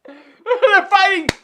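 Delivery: laughing. Real, not acted. Laughing loudly.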